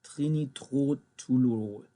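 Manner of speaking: "Trinitrotoluol" said very slowly in German, syllable by syllable; careful German word. Shown as a demonstration. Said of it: "I don't know".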